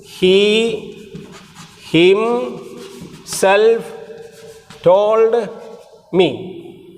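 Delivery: each word on its own, long pauses between; slow dictation. Shown as a demonstration. Teacher dictating the example sentence for students to copy, slowly. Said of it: "Example, example".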